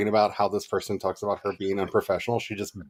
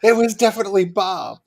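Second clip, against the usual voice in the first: higher, louder blaming voice; mock accusation; 10 semitones higher, 7 dB louder than usual. Crowd blames Bob for the goddess's fart, high and loud. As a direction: pin it on Bob with glee.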